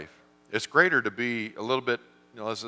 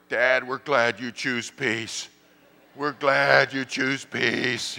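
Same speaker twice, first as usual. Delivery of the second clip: put-on slow, sing-song children's chorus; kids' sing-song reply; 4 semitones higher, 4 dB louder, much slower than usual. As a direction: kids in the backseat teasing dad. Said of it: "the kids in the backseat are like".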